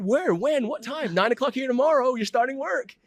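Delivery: excited exchange. Retold or imitated. Eager new recruit and boss setting a start, high and loud. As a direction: burst with eagerness.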